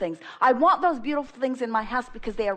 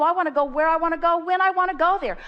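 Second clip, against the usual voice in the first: fast, higher, louder burst of eagerness; enthusiastic; 6 semitones higher, 3 dB louder than usual. Shown as a demonstration. Voicing the guest's wish with enthusiasm, fast and higher. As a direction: let the eagerness run away with you. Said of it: "put some emphasis in it".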